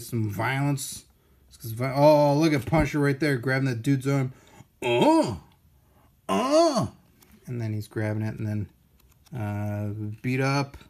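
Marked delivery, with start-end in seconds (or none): 4.8-6.9 loud mock grunts of pain, second much higher; mock pain cries. Acting out the thug whose arm is grabbed, loud mock yelps. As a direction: yelp like the guy getting hurt. Reacting to pictured fight.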